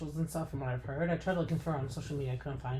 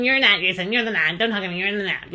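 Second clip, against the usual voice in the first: louder, sneering mock insult; mocking Angelica; 5 semitones higher, 11 dB louder than usual. Vlogger replays Angelica calling Jason an ad, loud sneering mock. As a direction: toss it off like a snotty mean girl, loudly. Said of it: "the way she said that".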